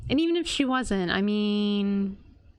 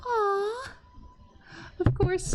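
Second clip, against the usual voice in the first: high tender aww; touched aww; 11 semitones higher, slower than usual. Real, not acted. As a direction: melt at a sweet moment. Touched by character.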